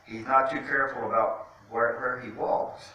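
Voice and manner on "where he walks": usual speaking voice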